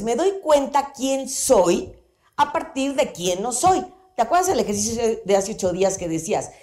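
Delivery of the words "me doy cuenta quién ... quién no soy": slowed for emphasis. Shown as a demonstration. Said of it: "key teaching line".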